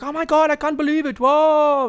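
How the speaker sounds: very high, crowing grandpa impression; hammed-up high-pitched amazement; amazed old pop. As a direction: marvel in a high put-on old man's voice. Imitates pop amazed at his catch.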